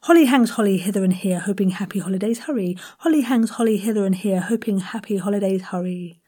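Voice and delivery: fast, nimble run at "holly hangs holly". Shown as a demonstration. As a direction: race through it with every H clear. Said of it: "one more time".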